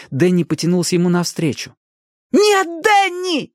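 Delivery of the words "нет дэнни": very high, drawn-out cry; alarmed scream. A narrator's reading. Ali to Danny as he drifts away, screaming. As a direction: scream out to stop him. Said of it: "закричала Али".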